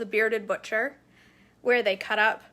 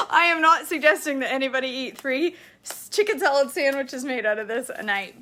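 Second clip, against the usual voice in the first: laughing; 4 semitones higher, 6 dB louder than usual. Real, not acted. Laughing over husband's three sandwiches.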